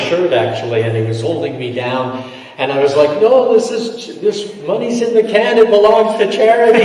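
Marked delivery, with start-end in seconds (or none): 3.2-6.8 pleading protest. Retold or imitated. Speaker pinned on a car pleads the money is charity, mock panicky. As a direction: protest helplessly, half-laughing.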